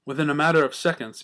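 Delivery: plain reading voice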